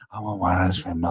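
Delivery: put-on low, quiet mumbling old man; Biden impression. Host imitating Biden's voice in the clip. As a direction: mumble it like a confused old president. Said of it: "Joe Biden accent".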